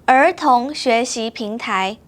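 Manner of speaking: 儿童学习平台 a bit slow and clear; steady, clear tones; slow model. Shown as a demonstration. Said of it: "now it's your turn".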